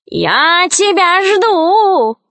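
high, very drawn-out witch call; Baba Yaga. A narrator's reading. Baba Yaga waiting for Alisa, drawn-out witch cry. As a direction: stretch every word in a witchy holler. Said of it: "закричала Баба Яга".

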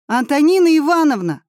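a loud, high group yell of her name; children call their missing teacher together, shouting. A narrator's reading. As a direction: shout it out with everyone. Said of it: "закричали мы все вместе".

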